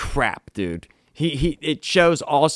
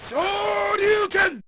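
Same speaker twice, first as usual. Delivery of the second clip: very high, strained mock yell; mock battle cry; much slower than usual. Batman unleashing everything on the Talon, high mock yell. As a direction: let it burst out of him.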